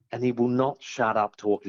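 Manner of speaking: normal talking voice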